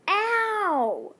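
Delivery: high, drawn-out ow; hammed-up pained yelp; cry of pain. A narrator's reading. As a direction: yelp a long falling ow. Hit by car door.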